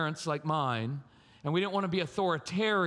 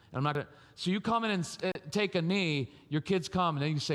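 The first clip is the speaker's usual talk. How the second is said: ordinary delivery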